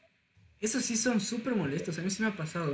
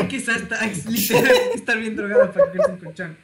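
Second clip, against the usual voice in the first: loud laughter through the line; laughing loudly; 2 semitones higher, 8 dB louder than usual. Real, not acted. Echoing a friend's joke, laughing loudly. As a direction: laugh it out much louder.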